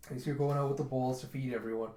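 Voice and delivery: plain reading voice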